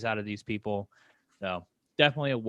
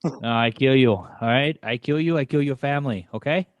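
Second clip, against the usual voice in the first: hammed-up Italian gangster threat, loud; mock Italian mobster, pushed and loud; bad Italian accent; 4 semitones higher, 7 dB louder than usual. Friend hamming a bad Italian accent at the podcast mic, loud mock threat. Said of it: "Your Italian accent is terrible".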